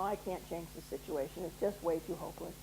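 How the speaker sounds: low, soft voice of resignation; mock hopeless. Inner voice giving up on negotiating, hopeless. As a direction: act out giving up, quietly. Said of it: "we have that hopeless thing".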